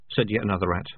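ordinary delivery

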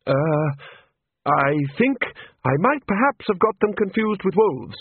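hesitate, then own up to the mistake; sheepish admission. A narrator's reading. Chair admits mixing sharks with wolves, sheepish hesitation. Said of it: "he mumbled".

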